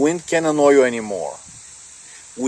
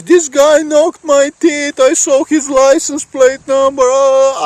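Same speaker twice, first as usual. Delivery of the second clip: much higher, louder tattletale; complaining victim; 12 semitones higher, 7 dB louder than usual. Retold or imitated. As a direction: whine to the cops about your teeth. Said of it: "he goes to the police".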